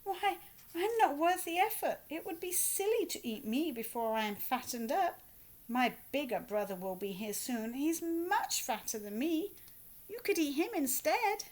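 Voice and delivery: a little goat talking his way out. A narrator's reading. Little goat's voice.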